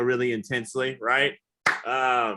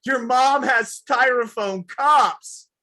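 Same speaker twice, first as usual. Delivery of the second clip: horrified; 10 semitones higher, 6 dB louder, much slower than usual. Retold or imitated. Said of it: "She's like".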